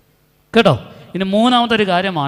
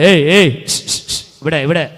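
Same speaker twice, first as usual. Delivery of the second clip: sharp hushing; 2 semitones lower, 5 dB louder than usual. Real, not acted. Preacher hushing restless children, stern and loud. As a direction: cut in with a stern hush.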